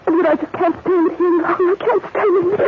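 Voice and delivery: high, loud tearful pleading; sobbing. Acted. Jane caught on the attic stairs, sobbing to Edward. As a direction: break down and beg through tears. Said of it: "stop sobbing".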